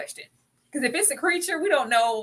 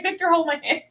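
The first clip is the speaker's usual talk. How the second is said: higher mocking plea; mock Tabitha plea; 8 semitones higher than usual. Mocking Tabitha reaching for Victor, higher mock plea. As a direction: whine it like her. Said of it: "It's like".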